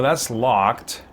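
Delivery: ordinary delivery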